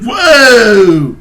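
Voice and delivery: loud drawn-out whoa; amazed shout. Retold or imitated. Fan overwhelmed by the scene, shouting whoa. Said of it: "I'm like".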